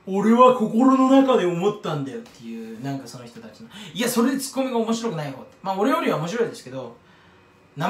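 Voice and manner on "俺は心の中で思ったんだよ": slow, loud imitation of a comedian's retort line; comedian's tsukkomi. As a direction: deliver it like a slow, weighty punchline. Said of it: "ツッコミがそんなに面白くない方の".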